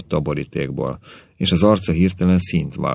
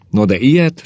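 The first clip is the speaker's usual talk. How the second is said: high, louder rising question of wonder; amazed; 8 semitones higher, 6 dB louder than usual. A narrator's reading. Janovic hearing of the murderer's letter, amazed. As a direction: marvel aloud, pitch leaping up. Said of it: "csodálkozott el Janovic úr".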